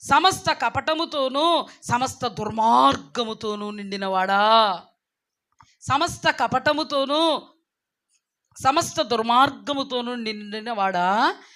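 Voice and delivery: loud, slow, drawn-out denunciation; thundering declamation with stretched words; fiery rebuke. Acted. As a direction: hurl each phrase as an accusation. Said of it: "ఏమని మాట్లాడుతున్నారో ఇక్కడ".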